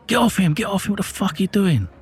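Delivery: high, urgent shout without much extra volume; retold angry yell. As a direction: call him off, outraged. Shouting at copper beating his brother.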